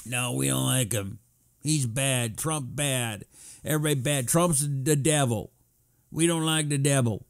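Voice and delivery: put-on voice parodying dim opponents; mocking Democrats. Mocks Democrats answering his vote question.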